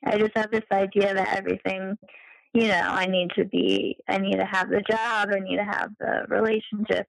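ordinary talk

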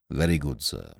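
a hint of a low, soft, deferential valet; lightly voiced butler, quiet and proper; Jeeves the butler. A narrator's reading. Butler answering his master, low and deferential.

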